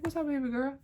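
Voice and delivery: a hint of an older man's casual hello; imitating old head. Coworker greeting her like an old head, lightly imitated. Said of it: "like he an old head".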